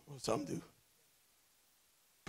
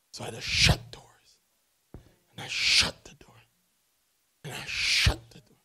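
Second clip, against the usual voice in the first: whispered; 9 semitones lower than usual. Real, not acted. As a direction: whisper it as if it still hurts. Whispered, tearful recollection.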